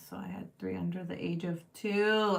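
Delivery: usual speaking voice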